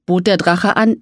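ordinary delivery, nothing marked

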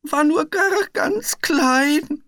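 Piggledy excuses himself, freezing: shivering. A narrator's reading. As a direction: shiver through the words. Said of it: "schlotterte Piggledy".

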